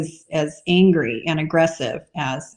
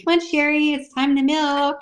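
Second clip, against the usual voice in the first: higher, coaxing call to a cow; 8 semitones higher than usual. Retold or imitated. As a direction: coax the sleepy cow awake. Waking the cow gently.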